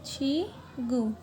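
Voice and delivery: छी गु said very slowly, syllable by syllable; slow demo. Shown as a demonstration. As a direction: spell out छी गु slowly for students. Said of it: "अर्थ को बोलते हैं".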